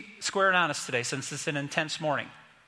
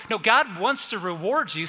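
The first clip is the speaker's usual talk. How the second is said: ordinary delivery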